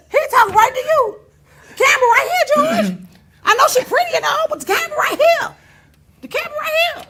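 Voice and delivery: high, mock-frantic calling out; retold inner protest, high and loud; exasperated redirect. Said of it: "that's why I'm like".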